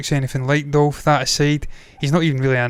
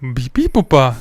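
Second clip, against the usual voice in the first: put-on slow, loud English-accented French; hammed-up bad French; foreign accent in French; 6 dB louder than usual. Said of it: "Joey from Friends, try to speak French".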